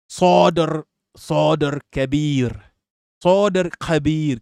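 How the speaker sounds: slow "Sadr Kabir" with open o, rolled r; colloquial Arabic pronunciation. Shown as a demonstration. Streamer showing chat colloquial Arabic, slow and careful. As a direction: model the dialect form slowly. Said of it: "Colloquial Arabic".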